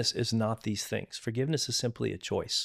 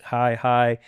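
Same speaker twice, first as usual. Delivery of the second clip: a quick, louder, chirpy-polite hi hi; mock breezy greeting; 7 dB louder than usual. Mimicking shallow greetings at group events, louder and chirpy. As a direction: toss off a hollow social hello.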